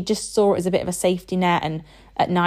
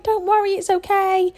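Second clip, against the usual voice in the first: much higher, soothing sing-song comfort; calm an upset little girl gently; reassuring mum; 12 semitones higher than usual. Retold or imitated. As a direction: mum soothing her daughter after a little accident, high and gentle. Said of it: "And I'm like".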